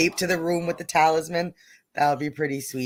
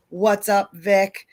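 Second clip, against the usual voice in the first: ordinary talk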